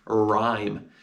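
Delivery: ordinary delivery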